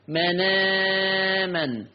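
"مناما" with the middle aa held out, slow; middle madd. Shown as a demonstration. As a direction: stretch "manaaaman" to a medium length, no hamza. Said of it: "نقل مع ثلاثة البدل". ("مناما" بالنقل مع توسط مد البدل)

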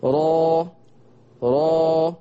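را with a full, thick ra and open a; heavy ra. Shown as a demonstration. Teacher leading students to repeat heavy ra. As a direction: say را heavy from the back of the tongue. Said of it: "لو مثلت إن دي مؤخرة لساني".

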